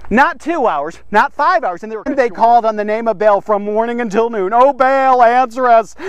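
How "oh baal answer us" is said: mock calling out. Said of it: "They shouted and screamed".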